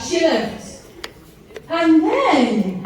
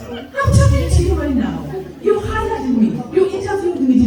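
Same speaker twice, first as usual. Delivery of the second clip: retold protest, pitched higher; indignant retort; 6 semitones higher than usual. Worker confronts the director who hired them, indignant. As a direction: challenge them in raised pitch, reenacting disbelief. Retells challenging boss over accent.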